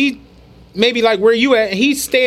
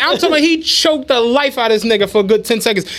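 usual speaking voice

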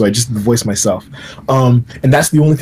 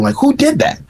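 amazed; 14 semitones higher than usual. Retold or imitated. Agent amazed by the performance.

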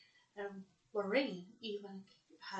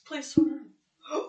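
words jolted by nasal spray; nasal spray reaction; 5 semitones higher, 15 dB louder than usual. Real, not acted. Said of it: "I just took some nasal spray".

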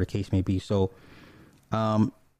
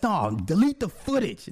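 short guy's protest; 8 semitones higher than usual. Retold or imitated. Jazz telling the owner to delete the footage, high and indignant.

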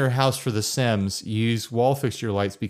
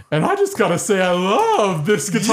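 higher, louder, crying moon character; 8 semitones higher, 4 dB louder, slower than usual. Pretend. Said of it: "the character with the moon voice".

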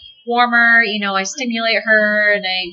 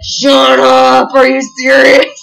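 louder, higher, slow shocked shout; louder disbelieving cry; amazed disbelief; 5 semitones higher, 8 dB louder, much slower than usual. Retold or imitated. Disbelief at name match.

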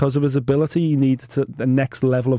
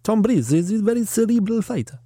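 a higher, put-on French Canadian accent, performed on request; 6 semitones higher than usual. Shown as a demonstration. Asked by his co-host, a bit of French Canadian accent. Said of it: "give us a bit".

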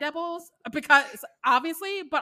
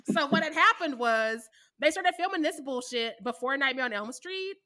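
ordinary talk

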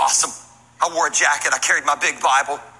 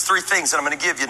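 usual speaking voice

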